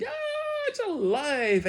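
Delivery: high-pitched startled shriek; comic scream. A narrator's reading. Man discovers the doll is alive, screaming. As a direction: scream it high, sliding down into the words. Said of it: "The man screamed".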